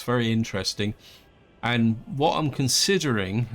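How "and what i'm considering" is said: "considering" picked out with clear emphasis; stress on considering. Real, not acted. Player cautiously telling viewers his plan, stress on "considering". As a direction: lean firmly on the word "considering". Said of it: "I emphasize the word considering".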